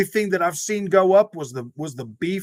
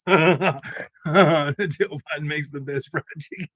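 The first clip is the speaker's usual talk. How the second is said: slow deadpan; much slower than usual. Acted. Same line repeated, slowed.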